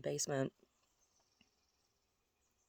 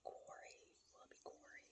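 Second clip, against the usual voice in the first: very soft whisper to oneself; faint, voiceless pondering; whispered musing; 24 dB quieter than usual. Real, not acted. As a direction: whisper the question almost inaudibly. Repeats the name to herself.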